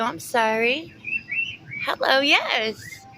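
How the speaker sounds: high, loud sing-song cooing at a bird; pet talk. Real, not acted. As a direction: coo to the parrots like babies.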